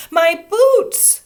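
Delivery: high, loud dismayed cry; upset. A narrator's reading. Girl crying out to the teacher, upset. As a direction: cry out for the boots. Child upset over boots.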